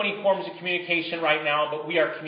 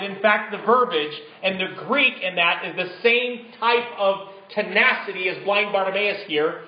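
ordinary talk, nothing marked

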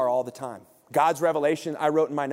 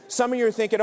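ordinary talk

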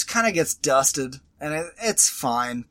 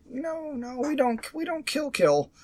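much higher, softer mock pious voice; mock Marvel voice; 9 semitones higher, 5 dB quieter than usual. Mocking Marvel's claim that heroes never kill, prim high mock voice. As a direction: play the prim hero who claims no killing.

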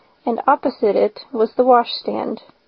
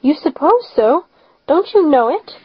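pitched higher in sharp disbelief; a sharp, higher "You suppose so?"; incredulous; 5 semitones higher than usual. A narrator's reading. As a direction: throw it back at him, higher. Marilla retorts in disbelief.

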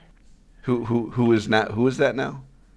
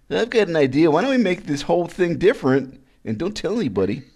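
lightly voiced eager young intern, a bit higher; 7 semitones higher than usual. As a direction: pitch up like a keen new intern. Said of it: "That, that's my intern voice".

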